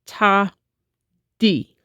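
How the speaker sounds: ta, then di, spoken as separate counting syllables; rhythm syllables. Shown as a demonstration. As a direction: say each syllable cleanly like a counting drill. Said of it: "this is pronounced".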